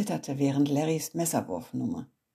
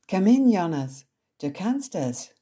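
loud, eager call to come in; encouraging; 3 semitones higher, 6 dB louder than usual. Acted. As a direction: cheer him on. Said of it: "motivierte mich Olivia". (Olivia ermuntert Jonas laut und begeistert)

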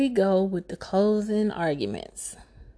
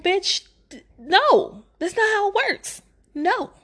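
high, fed-up mock judge; exasperated judge; 12 semitones higher than usual. The judge refuses Jasmine's request in a high exasperated mock voice. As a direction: shut her down in a high squeal.

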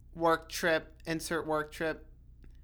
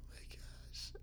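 whisper; 21 dB quieter than usual. Real, not acted. Laughing at her own crush.

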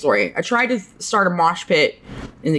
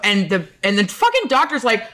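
ordinary delivery

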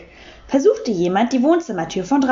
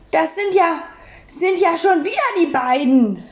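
stuttering repeat, pitched up in disbelief; 8 semitones higher than usual. A narrator's reading. Lürcher stutters, staring aghast through the door gap. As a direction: trip over the words, stunned. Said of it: "stotterte Herr Lürcher".

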